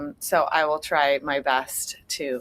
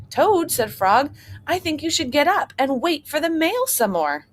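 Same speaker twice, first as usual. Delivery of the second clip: eager Frog; 9 semitones higher than usual. Acted. Frog urges Toad.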